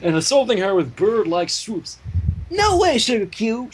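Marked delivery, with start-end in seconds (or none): 2.5-3.7 sneer. A narrator's reading. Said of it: "she sneered".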